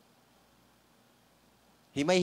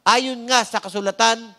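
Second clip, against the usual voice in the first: normal talking voice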